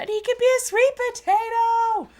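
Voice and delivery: very high, loud sing-out; excited outburst. Real, not acted. Streamer seizing on a paint idea, excited outburst. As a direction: belt it out way up high in delight. Excited chat suggestion to viewers.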